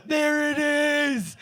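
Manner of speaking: slow, loud, drawn-out exclamation; excited discovery. Retold or imitated. Spotting the canoe from the hilltop, slow and excited. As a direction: call it out triumphantly.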